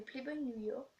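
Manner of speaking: English brand name with a French accent. Real, not acted. Vlogger naming a shower gel, French-accented English. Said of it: "Désolée pour mon accent anglais".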